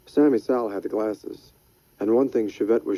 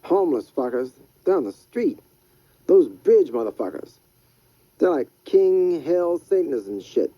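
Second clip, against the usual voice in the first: lightly raised, rough street hustler; Freddy's voice; 6 semitones higher than usual. Acted. Freddy warns Rydell about bridge people, raised hustler voice.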